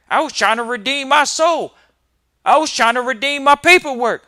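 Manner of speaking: rising mock rant.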